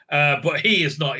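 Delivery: ordinary talk, nothing marked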